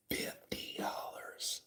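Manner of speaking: slow, drawn-out whisper; dramatic whisper. Real, not acted. Storyteller repeats the tip amount for effect, slow whisper.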